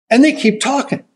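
ordinary talk

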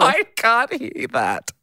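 high, slow laughing denial; helpless protest. Real, not acted. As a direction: plead that you honestly can't. Protesting own hearing.

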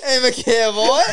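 put-on high, laughing Aussie cowboy accent; Australian-sounding cowboy. Player failing the cowboy challenge, Australian-tinged accent. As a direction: botch an American cowboy into Australian. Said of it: "I sound like an Australian".

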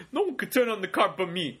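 a touch of a cool Travolta swagger; lightly voiced smooth tough guy; John Travolta. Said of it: "I sounded like a freaking John Travolta".

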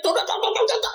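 put-on high-pitched gibberish parody of African accent; mock African accent. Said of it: "you guys make it sound so like".

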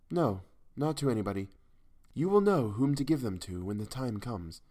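a hint of a hushed, solemn stranger; the ghostly woman, calm and certain; lightly voiced mysterious woman. A narrator's reading.

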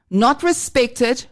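ordinary delivery

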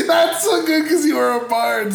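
high, loud laughing words. Real, not acted. Cracking up at the bard's joke, laughing. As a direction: talk through delighted laughter.

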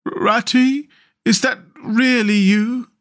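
high, thin-spirited feeble little voice; feebly and slowly, hoping it's a friend; small, weak, high-pitched character voice. A narrator's reading. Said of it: "came a feeble voice saying".

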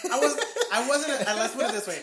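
laughing through the words; chuckling while talking. Real, not acted. Laughing at himself.

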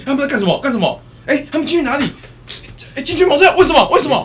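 high and loud, staged panic; hammed-up fake alarm. Hosts in the van faking outrage at a blank monitor, hammed up. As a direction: overact shock at an empty monitor. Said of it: "你要开始骂说".

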